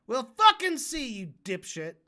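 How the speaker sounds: erupt, punching the swear word hard; angry outburst. Real, not acted. Streamer challenging the debater's prediction, angry shout.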